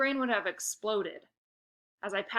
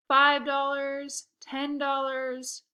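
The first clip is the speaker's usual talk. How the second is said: very slow, savoured counting; slow gloating count; 5 semitones higher, 4 dB louder, much slower than usual. Acted. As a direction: count each bill slowly to rub it in. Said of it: "I slowly counted out the bills".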